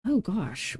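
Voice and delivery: normal talking voice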